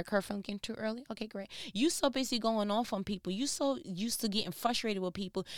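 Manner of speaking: ordinary talk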